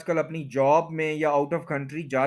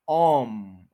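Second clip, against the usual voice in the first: ओम held long as AWM; full-energy stress; 3 semitones lower, 3 dB louder than usual. Shown as a demonstration. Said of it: "ओम पे पूरा आपने energy देनी है".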